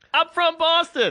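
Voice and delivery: high hammed-up announcement of home town; mock proud Boston guy. Friend mocking the Boston bar guy, high and hammed-up. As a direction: puff up and squeak it like a clueless tourist. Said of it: "guy walking in going".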